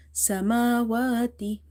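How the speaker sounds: Samawati slowly, with both "aa" vowels lengthened; stretched vowels. Shown as a demonstration. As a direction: hold "maa" and "waa" in Samawati. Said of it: "we will read by stretching here".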